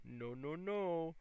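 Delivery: drawn-out mock refusal, rising at the end; playful scolding no-no-no, lifting on the last; Dikembe Mutombo style. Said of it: "Dikembe Mutombo style".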